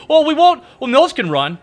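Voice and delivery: high, loud mocking voice; high loud mock of a clueless protester; mocking opponent. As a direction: squawk it like a dumb opponent.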